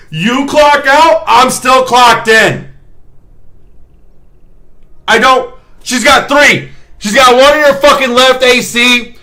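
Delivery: high, loud exasperated tirade at the doctor; furious inner rant. Retold or imitated. Nurse silently raging at the doctor's questions, high and loud. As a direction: unload the whole shift's rage in your head. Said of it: "I didn't say none of this shit, but I thought it".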